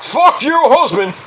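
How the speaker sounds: high, drawn-out mocking comeback; mock defiant retort. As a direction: throw it back at the wife, high and slow. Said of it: "Logan's like".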